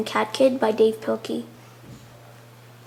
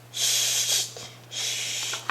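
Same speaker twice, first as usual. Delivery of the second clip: soft unvoiced shh; breathy shush sound; 9 dB quieter than usual. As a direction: hush like a whisper.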